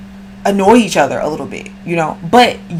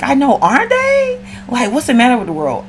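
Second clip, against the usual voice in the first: her friend's reply; 6 semitones higher than usual. Retold or imitated. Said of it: "And she's like".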